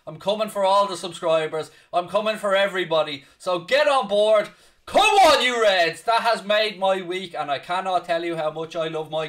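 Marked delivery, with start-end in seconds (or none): 3.4-6.0 big rising rallying cry; rally the fans, loud and high; rallying shout. Real, not acted. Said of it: "rallying cry to fans".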